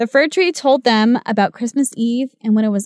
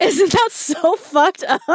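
laughing disbelief; 8 semitones higher, slower than usual. Real, not acted. Laughing at the sad ending.

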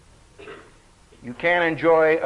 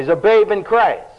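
normal talking voice

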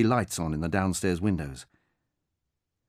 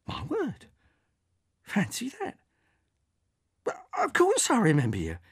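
put-on astonished old woman; 13 semitones higher than usual. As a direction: marvel that it is really him. Margaret astonished, softer.

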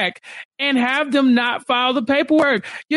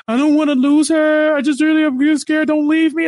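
louder, higher, whiny mock of the husband begging; mocking whiny plea; 6 semitones higher, 4 dB louder than usual. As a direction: sneer his pleading back at him, pitched up. Mocking Sumit's pleading.

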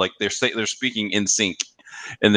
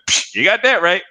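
higher, punchy imitated old man; old man's catchphrase; 10 semitones higher, 4 dB louder than usual. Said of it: "my friend Derek ... going".

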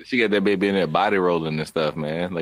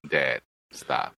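mock deadpan kid; 7 semitones lower, 5 dB quieter than usual. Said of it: "my baby looking at me and just be like".

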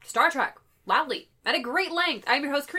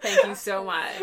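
laughing thanks; 6 semitones lower than usual. Real, not acted. Bows after laughter.

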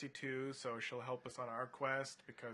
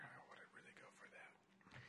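whisper; 20 dB quieter than usual. Real, not acted. Aside, whispered.

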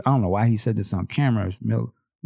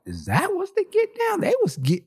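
much higher, incredulous question; high mock-amazed question; stunned disbelief. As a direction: sound floored by the news. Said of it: "I was like".

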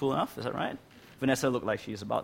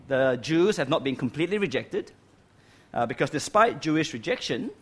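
ordinary talk

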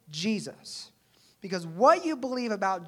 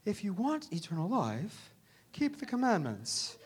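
softer, serene, soothing Jesus; gentle, placid voice of a peaceful guy; calm, peaceful; 5 dB quieter than usual. Acted. Preacher imitating the calm Jesus people imagine, very peaceful. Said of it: "we think Jesus is this really calm tone, you know, just very peaceful guy".

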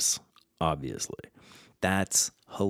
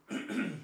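throat clearing; 11 semitones higher, 9 dB quieter than usual. Real, not acted. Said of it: "when I have to clear my throat".

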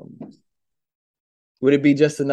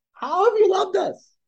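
incredulous retort. Retold or imitated. Israelites' incredulous retort to God.

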